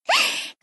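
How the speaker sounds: a quick bright giggle up high; little teasing laugh to the producer; short laugh. Acted. Playful idol teasing producer.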